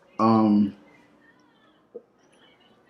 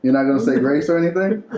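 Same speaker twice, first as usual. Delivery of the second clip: ordinary talk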